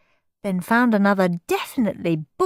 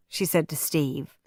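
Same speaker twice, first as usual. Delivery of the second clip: usual speaking voice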